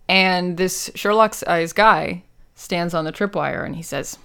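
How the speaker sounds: usual speaking voice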